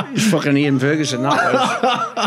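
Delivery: words spoken through real laughter; laughing while talking. Real, not acted. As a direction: laugh as you name him, then let the laugh take over.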